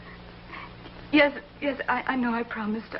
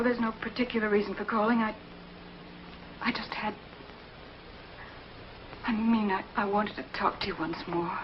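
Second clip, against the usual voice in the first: normal talking voice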